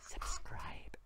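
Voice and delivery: barely audible whisper; whispered aside. Pretend. Prankster to his viewers after clickbait talk, whispered. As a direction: slip it in under your breath.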